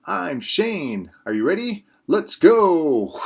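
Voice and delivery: upbeat, fast YouTube host; bright energetic video opener; presenter intro. Acted.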